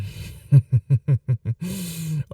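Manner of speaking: dark, pleased laugh; wicked laugh. Acted. Vampire raising her glass of blood, wicked laugh. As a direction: relish the hunter's unease.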